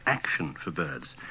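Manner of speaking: ordinary delivery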